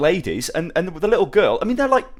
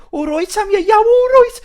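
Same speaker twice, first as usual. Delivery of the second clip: much higher, louder Yam Yam voice; 6 dB louder, slower than usual. Retold or imitated. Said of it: "Yam Yams".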